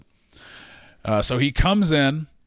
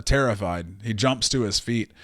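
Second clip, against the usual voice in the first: ordinary talk, nothing marked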